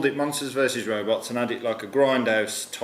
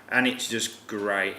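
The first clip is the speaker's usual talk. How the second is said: ordinary talk, nothing marked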